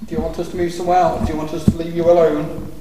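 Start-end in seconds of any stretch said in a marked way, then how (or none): none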